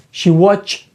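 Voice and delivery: "watched" as watch, tiny gap, then t; ending T. Shown as a demonstration. Teacher repeating the right form for learners, "watched" as watch-t. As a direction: detach a voiceless t at the end of "watched". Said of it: "а закінчення «ти»".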